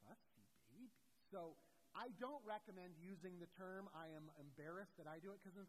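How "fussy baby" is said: soft, lower teasing retort; understated mocking comeback; needling taunt. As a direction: needle the child quietly, pitch lifting at the end. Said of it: "And I'm like".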